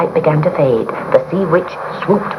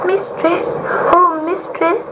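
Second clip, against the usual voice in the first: high pleading kitten voice; a small cat begging over the storm; kitten crying out; 12 semitones higher, slower than usual. A narrator's reading. Kitten calling up to the sea witch for rescue. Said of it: "he cried above the storm".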